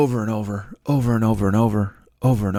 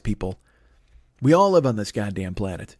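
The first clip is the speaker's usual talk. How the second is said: ordinary talk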